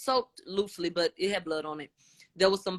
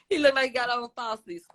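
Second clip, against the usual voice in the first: amused aside, higher pitched through laughter; tickled, laughing tease; 5 semitones higher, 4 dB louder than usual. Real, not acted. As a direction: laugh while getting the joke out. Joking aside about the eyelashes.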